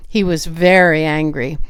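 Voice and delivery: usual speaking voice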